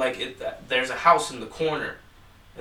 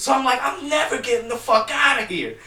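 panicked; 9 semitones higher, 8 dB louder than usual. Retold or imitated. Retold panic in the factory.

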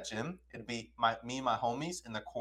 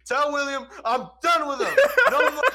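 high, loud mock outburst; pissed-off girl; 11 dB louder than usual. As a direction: imitate her fuming, pitch way up.

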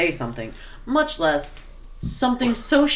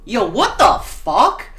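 mock loud outburst; 2 semitones higher, 5 dB louder, much slower than usual. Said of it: "Blaze said very loudly, like, audibly".

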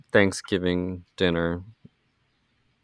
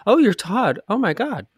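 high, loud mock gasp of recognition; a high put-on surprised girlfriend voice; mock surprise; 13 semitones higher, 4 dB louder than usual. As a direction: act out a girl startled but not scared. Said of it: "And she's like".

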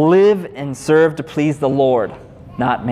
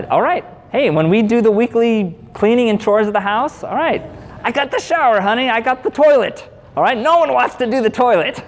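a lightly voiced eager husband, higher and bright; cheerful volunteering; 8 semitones higher than usual. Husband cheerfully tells wife he'll take the toilet, lightly acted. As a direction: chirp it out like you're happily taking the worst job.

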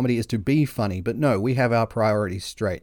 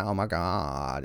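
put-on slow, creaky valley-girl "oh my god"; Kardashian vocal fry; 6 semitones lower, 7 dB quieter, slower than usual. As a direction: drag it out in lazy fry. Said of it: "that Kardashian vocal fry".

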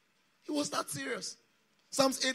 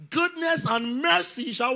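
slow, loud, raised scripture quote; loud declamation; 6 semitones higher, 9 dB louder, slower than usual. Real, not acted. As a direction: declaim it so the congregation fills in. Declaims psalm for congregation to complete.

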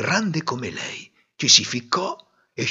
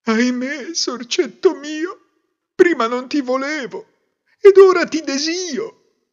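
weeping lament; 5 dB louder than usual. A narrator's reading. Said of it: "prese a piangere".

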